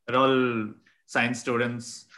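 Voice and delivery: normal talking voice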